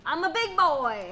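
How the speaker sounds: put-on high, slow childish boast; proud little-kid brag; big boy voice. Playful boast in game.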